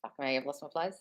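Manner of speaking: usual speaking voice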